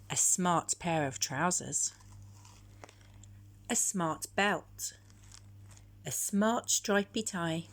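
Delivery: usual speaking voice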